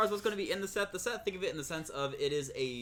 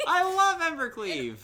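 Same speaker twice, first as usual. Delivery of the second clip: high, loud fangirl gush; excited gush; 12 semitones higher, 8 dB louder than usual. Real, not acted. Fangirling over the card.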